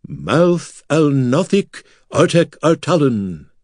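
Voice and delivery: slow, puffed-up pompous declaiming; exaggerated bravado. A narrator's reading. Corin imitating a grave curse, hammed-up bravado. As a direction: swell up and pronounce it grandly. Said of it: "lowered his voice, speaking the words with exaggerated bravado".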